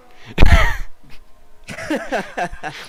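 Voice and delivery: loud high-pitched laugh; high burst of laughter. Real, not acted.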